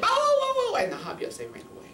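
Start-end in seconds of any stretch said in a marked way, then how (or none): 0.0-0.8 loud, high-pitched bow-wow; dog bark. Acted. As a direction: bark out high to scare them off. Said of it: "Turpie, she said".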